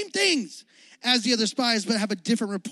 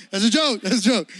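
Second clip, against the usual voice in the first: normal talking voice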